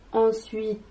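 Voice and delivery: ordinary talk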